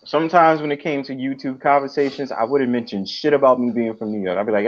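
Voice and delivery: ordinary delivery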